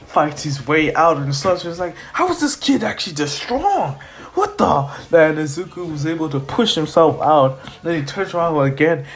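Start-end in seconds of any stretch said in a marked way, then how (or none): 2.1-4.9 high-pitched villain disbelief; incredulous villain. Acted. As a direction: sound baffled by the kid's strength. Villain baffled by kid's strength.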